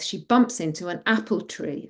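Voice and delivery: plain reading voice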